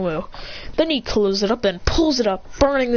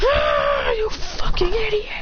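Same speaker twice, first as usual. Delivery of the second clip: high, drawn-out raging yell; furious attack scream; 11 semitones higher, slower than usual. Acted. Character blasts the Nomu into a wall, furious high scream. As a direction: scream the insult while attacking.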